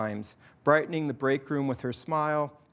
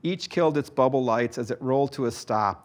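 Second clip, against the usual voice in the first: usual speaking voice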